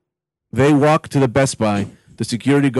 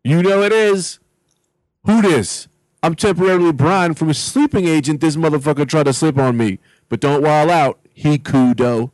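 self-important mall cop; 3 semitones higher than usual. Acted. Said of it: "Vortex just starts speaking like the security guard".

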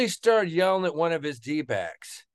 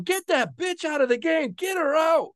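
retold sideline yell; 10 semitones higher than usual. Coach yelling from the sideline to pull a player, high mock yell. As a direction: bark it like a furious coach from the sideline. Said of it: "He's on the sideline going".

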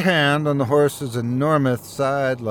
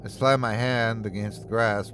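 ordinary delivery

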